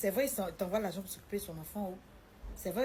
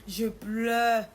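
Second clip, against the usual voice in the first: loud, stretched-out lament; hammed-up wail, dragging the last word; mock wailing; 2 semitones higher, 9 dB louder than usual. Wife retelling her tears on the journey, mock wailing. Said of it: "il dit, il pleure".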